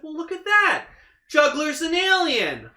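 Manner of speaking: high, loud put-on exclamation; exaggerated high-pitched reveal; mock astonishment. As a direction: announce it in hammed-up amazement. Acting out director's mock discovery.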